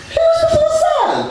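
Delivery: high, flustered squeak; embarrassed guy losing track, squeaking; panicked squeak. Retold or imitated. Panicked naming in room.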